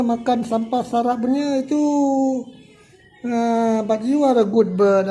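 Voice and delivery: lifted, carrying voice for a bird on the sill; coaxing a bird. Real, not acted. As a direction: call sweetly to a bird to lure it back.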